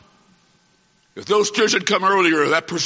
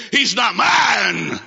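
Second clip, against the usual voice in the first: cold rejection; 3 semitones higher, much slower than usual. Retold or imitated. Woman disowning her injured son, slow cold shout. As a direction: drop each word like a stone. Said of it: "literally dropped the boy on the pavement and said".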